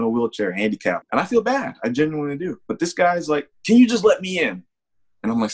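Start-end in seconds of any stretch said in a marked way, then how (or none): 3.7-4.6 high, louder pushy plea in a put-on voice; imitating the old man. Old man insists again.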